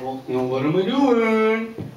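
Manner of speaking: coaxing teacher. Retold or imitated. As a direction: ask like a teacher fishing for an answer. Teacher coaxing a first grader.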